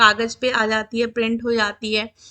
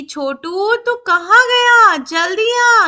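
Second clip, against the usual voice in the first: louder, higher, drawn-out mock call; imitated calling out; 7 semitones higher, 5 dB louder, slower than usual. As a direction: act out calling a child in from afar. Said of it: "उसको बुला रहे हैं". (ऊँची आवाज़ में बुलाने की नकल)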